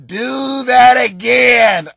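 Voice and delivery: put-on slow drawn-out shout of an eager old lady; yelling old lady. Old lady in the crowd yells at the comic, slow loud holler. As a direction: holler it up at the stage like a thrilled old fan. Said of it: "she yells up".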